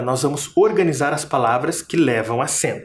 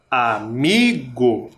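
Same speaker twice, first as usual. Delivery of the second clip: Amigo drawn out slowly with a long, swelling MI; stress on MI; 3 semitones lower, much slower than usual. Shown as a demonstration. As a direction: lean hard and long on the MI in Amigo. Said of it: "tem uma sílaba que é mais forte". (Amigo com o MI forte e alongado)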